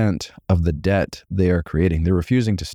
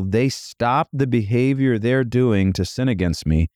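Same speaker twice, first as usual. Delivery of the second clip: ordinary talk, nothing marked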